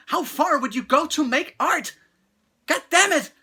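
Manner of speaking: high, loud, indignant demand; real angry outburst. Real, not acted. Reviewer demanding answers from the director, furious outburst. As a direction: build from challenge to a furious curse. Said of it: "I'm getting so mad".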